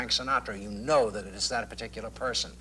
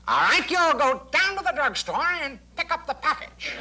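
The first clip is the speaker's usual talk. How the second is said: much higher, clipped and comic, laughing through it; exaggerated Smart voice; 4 dB louder than usual. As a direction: ham up your signature TV detective voice, clipped and high. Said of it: "take that voice and exaggerate it".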